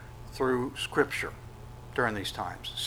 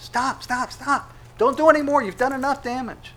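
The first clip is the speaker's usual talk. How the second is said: high, frantic pleading to make it stop; people pleading to stop; 11 semitones higher, 5 dB louder than usual. Acted. Resisting people begging God to stop, in a high frantic plea. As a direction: cry out like someone watching their house torn apart.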